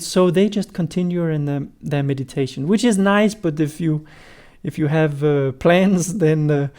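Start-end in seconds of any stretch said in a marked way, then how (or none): none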